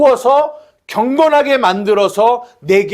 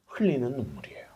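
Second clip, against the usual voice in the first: 속삭임; 8 semitones lower, 17 dB quieter, faster than usual. Real, not acted. 은혜의 눈물을 말함.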